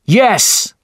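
high, loud cheer; crew shout. A narrator's reading. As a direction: cheer it back together. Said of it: "they shouted".

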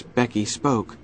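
usual speaking voice

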